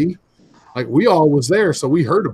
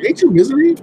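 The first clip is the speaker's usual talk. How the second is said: high, louder questioning; retold surprised recognition; 12 semitones higher, 6 dB louder than usual. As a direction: act out suddenly recognising someone. Acts out friend recognising the man.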